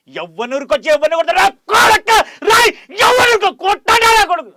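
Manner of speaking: screaming, cracking, very high and loud rage; psycho villain roar. Acted. Actor demoing his psycho villain line at full roar. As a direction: roar it like a crazed villain about to strike. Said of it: "voice పలికిపోతుంది".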